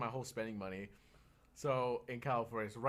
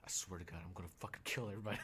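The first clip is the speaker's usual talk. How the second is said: much softer, fast mutter; inner muttered rage; 6 semitones lower, 9 dB quieter, much faster than usual. Retold or imitated. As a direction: seethe under your breath. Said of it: "in my head".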